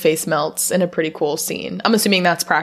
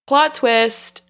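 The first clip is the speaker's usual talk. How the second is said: ordinary talk, nothing marked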